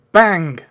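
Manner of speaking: loud mouth gunshot sound; loud vocal bang; gunshot noise. Retold or imitated. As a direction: shout a gunshot effect. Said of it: "providing background sounds".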